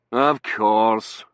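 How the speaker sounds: loud, exasperated resignation; disgusted. A narrator's reading. Dunworthy hearing of another absent colleague, disgusted. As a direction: push it out with disgust. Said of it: "said disgustedly".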